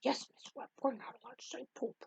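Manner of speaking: very soft, confidential child imitation; hushed little kid. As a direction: tell teacher quietly, as a child. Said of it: "another kid comes up to me".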